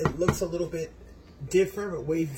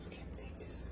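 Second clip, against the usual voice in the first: whispered to herself before a bite; 16 dB quieter, much faster than usual. Real, not acted. Cook about to take a hot bite, whispering. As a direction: hush it like a secret.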